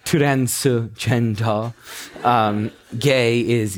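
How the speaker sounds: Korean accent. Shown as a demonstration. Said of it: "transgender said with a Korean accent".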